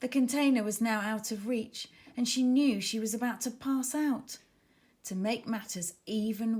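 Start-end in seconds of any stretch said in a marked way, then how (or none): none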